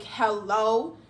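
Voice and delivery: HELLO drawn out with a rising end; a challenging "Hello?", stretched and lifted; pointed hello. Retold or imitated. Her pointed hello back, retold.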